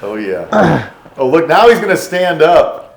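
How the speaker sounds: loud excited teasing. Real, not acted. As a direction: call it out with glee. Teasing camera guy standing up.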